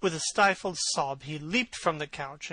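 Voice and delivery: plain reading voice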